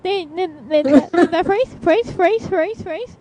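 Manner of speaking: loud, stammering excited babble; frantic fangirl disbelief. Retold or imitated. Fan reliving a TV moment, stammering excited disbelief. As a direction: get stuck repeating words in giddy shock.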